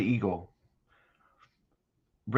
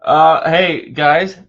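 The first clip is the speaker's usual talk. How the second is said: normal talking voice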